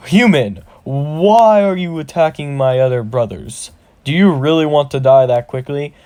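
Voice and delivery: booming challenge to a puny human; angry orc shaman. Acted. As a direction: bellow it as an outraged orc. Orc shaman confronts attacker.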